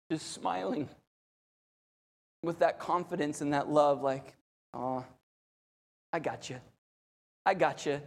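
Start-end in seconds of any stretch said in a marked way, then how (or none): 4.7-8.0 much softer and lower, gently comforting; Jesus soothing the sinking Peter; tender reassurance. Acted. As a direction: hold him close with your voice.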